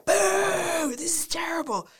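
high mocking boo, then a half-whispered complaint; mock crowd boo. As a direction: play a jeering audience, shrill then hushed. Audience booing at audition.

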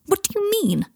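very high-pitched indignant outburst; erupting. A narrator's reading. Harry to Malfoy refusing treatment, erupting. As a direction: burst out in high-pitched disbelief. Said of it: "erupted Harry".

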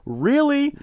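very high questioning squeak; acted hopeful surprise. Son hearing dad loves him, surprised. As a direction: lift it high in disbelief.

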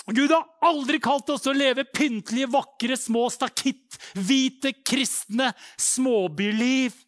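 loud, high shout with slow, stretched key words; full-force preaching. Real, not acted. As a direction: hammer it home at a roar.

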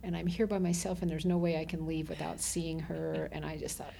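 ordinary delivery

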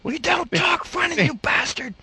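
mock angry Swede. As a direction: squeal it like an offended Swede.

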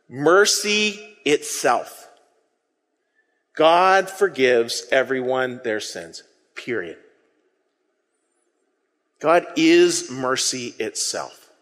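slow, weighty sermon emphasis; a slow hushed proclamation; slow solemn. Real, not acted. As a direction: let each word land slowly. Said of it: "slow solemn emphasis".

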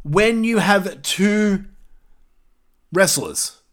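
slow, deliberate lecturing pace; "wrestlers" drawn out and underlined; talking slow. Shown as a demonstration. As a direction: spell it out slowly like to a child. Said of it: "let me talk slow for you so you understand".

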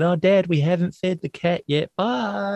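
high-pitched mock child heading out the door; kids calling to Dad. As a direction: play the kids breezily passing off a chore. Kids calling out as they leave.

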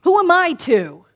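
inner doubting voice. Retold or imitated. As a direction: voice the doubt in your head. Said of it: "Doubt creeps in".

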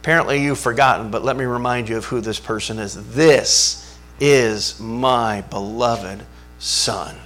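God's gentle rebuke. Retold or imitated. Preacher voices God rebuking Peter, quietly firm. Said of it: "God turns and says to Peter".

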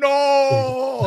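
high, slow laughing shout of no. Retold or imitated. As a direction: yell it out through a laugh. Character thrown out window again.

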